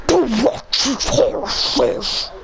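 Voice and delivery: slow villain line; lightly voiced supervillain; Doctor Doom voice. Shown as a demonstration. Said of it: "let me try".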